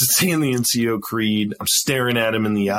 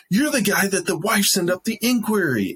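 high, excited recognition; sudden high-pitched gotcha; realization; 11 semitones higher than usual. Retold or imitated. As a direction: land it like a gotcha. Quoting major's realization.

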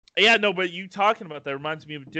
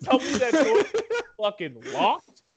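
lightly pitched-up mock rant; mock outraged Michael; 6 semitones higher than usual. Friend keeps mimicking Michael's rant at the bar guy, mock outrage. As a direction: play the offended bro, hammed. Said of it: "how he says it".

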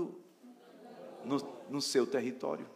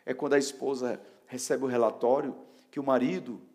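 ordinary delivery

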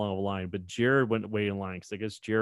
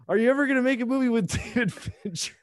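high, put-on teasing voice; mocking question; 14 semitones higher than usual. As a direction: tease the star in a mock fan voice. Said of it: "kinda making fun of him".

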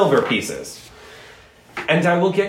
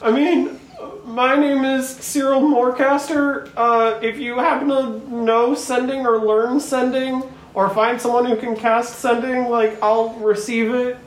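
put-on higher, slow, earnest young man; Cyril speaking; 6 semitones higher than usual. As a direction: introduce yourself carefully, a bit unsure. GM voices Cyril.

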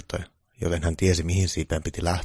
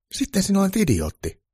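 high, loud outburst of pique; indignant insult; 5 dB louder than usual. A narrator's reading. Rejected troll applicant insulting Santa, high and indignant. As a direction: spit back an insult in a raised voice.

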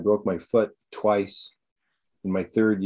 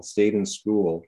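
usual speaking voice

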